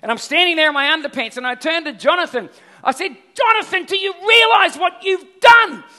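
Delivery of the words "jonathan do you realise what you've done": furious shout, much higher and louder; angry yelling at son. Retold or imitated. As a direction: yell at the kid over the lost rod. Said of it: "I started to lose my marbles".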